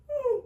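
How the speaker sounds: a high, drawn-out knowing hmm; suspicious high hum. Retold or imitated. Seeing the rude customer walk back in, a sly high hum. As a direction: size them up with a sly hum. Suspicious reaction to her return.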